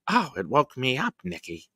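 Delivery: a mother's gentle complaint, imitated; mother impression. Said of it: "that's fucking spot on".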